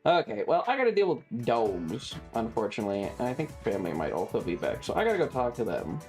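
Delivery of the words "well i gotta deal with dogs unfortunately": ordinary delivery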